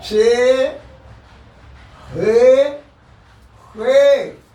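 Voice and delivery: "हे" pushed loud from the throat; throaty he. Shown as a demonstration. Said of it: "हलक से निकलेंगे". ("हे" हलक से ज़ोर से)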